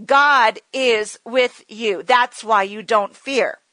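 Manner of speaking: usual speaking voice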